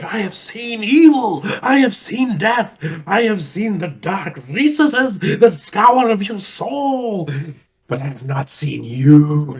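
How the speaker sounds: hammed-up theatrical monologue; Shakespearean Broadway delivery. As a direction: play it to the back row like Shakespeare. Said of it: "total Broadway, Shakespearean".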